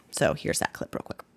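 quick whispered aside. Real, not acted. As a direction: drop to a whisper to cue the clip. Quick aside before playing clip.

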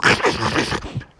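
rough voiceless chomping noise; imitated dog gnashing; beast gnawing snarl. A narrator's reading. Beast gnaws at Froggy, rough snarl.

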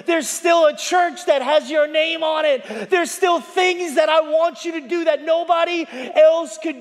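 high, loud preacher's shout as Jesus; preaching yell. Acted. Preacher voicing Jesus's promise to Peter, shouting. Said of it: "Man, this guy could yell".

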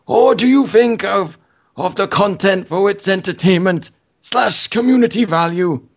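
put-on high, strained old man voice. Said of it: "give him an old man voice".

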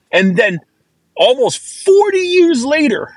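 real tears pushing the pitch up and thin; emotional, near sobbing, slow in places; choked up, crying. Real, not acted. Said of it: "and I'm crying".

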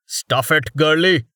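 rude, scornful snap; snapping rudely. A narrator's reading. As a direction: brush her off with a snap. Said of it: "the newcomer snapped at her".

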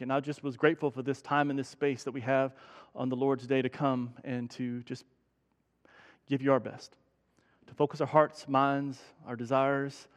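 low, soft, measured prayer voice; hushed, unhurried public prayer; spoken prayer. Real, not acted. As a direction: pray gently on behalf of the congregation. Leads the congregation in prayer.

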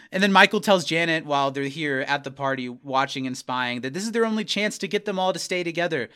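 usual speaking voice

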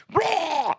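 high-pitched yelled roar; imitated roar of an unglued coach; mock roar. Coach roaring at poor kids, retold yelling. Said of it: "I'm yelling and the veins are sticking out".

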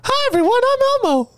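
high little puppet monster voice; Elmo voice. Pretend. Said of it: "I know one".

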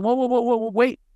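high, rapid string of whoas; high-pitched, hurried protest. Retold or imitated. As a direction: cut in to halt an accusation. Retold plea to stop accusations.

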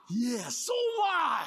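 a pastor revealing he is one too; excited shout. Retold or imitated. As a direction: announce it with delight. Excited retort.

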